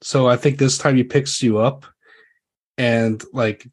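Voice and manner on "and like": ordinary talk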